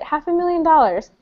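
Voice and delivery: ordinary talk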